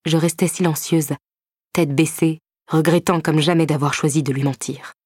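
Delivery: ordinary delivery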